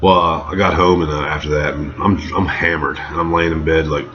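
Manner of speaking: ordinary delivery, nothing marked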